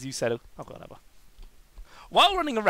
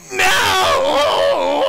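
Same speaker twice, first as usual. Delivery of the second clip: long, high, screaming "no"; yelled; 12 semitones higher, 9 dB louder than usual. Acted. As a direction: yell it out in melodramatic despair. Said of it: "yelled Sonic".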